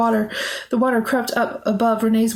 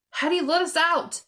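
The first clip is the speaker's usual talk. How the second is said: crying out; 7 semitones higher, 4 dB quieter than usual. A narrator's reading. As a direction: cry out to be freed. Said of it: "Renee cried".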